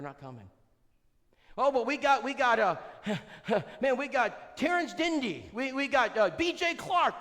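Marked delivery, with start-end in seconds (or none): 1.6-7.2 higher, louder, slow boasting; hammed-up proud churchgoer; mock boastful member. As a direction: show off the preacher names. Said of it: "mocking church members boasting".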